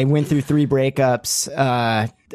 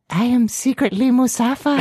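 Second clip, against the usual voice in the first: much higher squeaky voice, laughing; Musafa voice; 11 semitones higher than usual. Acted. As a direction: reveal it in the squeaky Jawa voice. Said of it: "talk in his voice".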